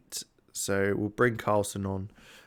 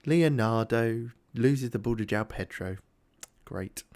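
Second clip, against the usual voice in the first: ordinary talk, nothing marked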